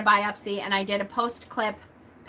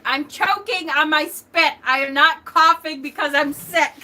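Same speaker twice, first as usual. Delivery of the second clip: raised protest; 6 semitones higher, 5 dB louder than usual. Retold or imitated. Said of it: "And I'm like".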